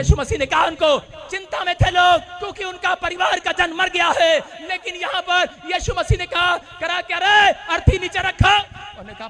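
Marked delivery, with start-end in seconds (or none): 0.0-7.7 loud, high revival preacher shout; rousing faith proclamation, building to a long held finish; full-force preaching. Real, not acted. 7.7-8.6 high, loud re-enacted command; commanding shout of rebuke; confident scolding order. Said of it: "इतने विश्वास से डांट रहा है".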